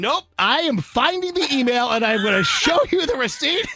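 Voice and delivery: high, insistent retort; stubbornly indignant. Retold or imitated. Customer insisting on showing staff the email, indignant. Refuses staff offer, proves his point.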